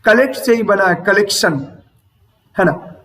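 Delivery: normal talking voice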